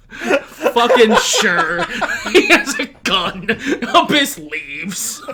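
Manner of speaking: laughing through the words; can't stop laughing while speaking. Real, not acted. Reacting to surprise gun reveal.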